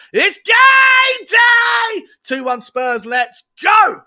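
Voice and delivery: very high, loud, stretched shout; game day shout. Retold or imitated. Reading a fan's message as a huge shout. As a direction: belt it like a terrace chant.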